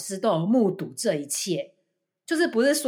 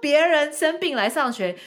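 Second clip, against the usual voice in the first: higher, louder exasperated hello; indignant outburst; 8 semitones higher, 8 dB louder than usual. Real, not acted. Teacher venting about the hypocritical mother, louder and higher. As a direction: vent at the mom's hypocrisy.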